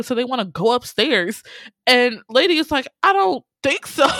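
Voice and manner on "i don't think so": high, loud mock warning; defiant refusal. Dog stops cats going upstairs to baby, loud mock defiance. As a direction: block their way with a sassy, raised voice.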